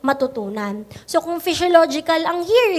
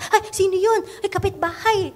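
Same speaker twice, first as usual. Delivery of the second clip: mock nosy neighbor; 4 semitones higher, faster than usual. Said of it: "Re-responde ka".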